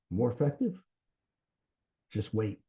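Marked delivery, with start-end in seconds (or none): 0.1-0.8 "More effective" echoed softly with a rising, higher questioning tune; mirroring question. Shown as a demonstration. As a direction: echo "More effective" back as a soft, curious question. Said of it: "rephrasing it as a question".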